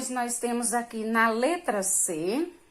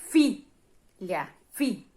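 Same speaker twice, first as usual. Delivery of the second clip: "Filha" split, then "fi" repeated alone; penultimate stress; much slower than usual. Shown as a demonstration. Teacher repeating the example for pupils, "fi" picked out as stressed. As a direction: separate "Filha" and single out its strong "fi". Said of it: "A sílaba tônica é a penúltima".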